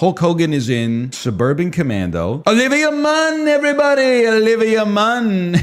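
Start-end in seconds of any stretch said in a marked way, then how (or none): 2.5-5.6 high, loud hammed-up Trump announcing a guest. Streamer doing his Trump voice for chat, hammed-up. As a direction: belt the name out like a rally. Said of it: "in my Trump voice".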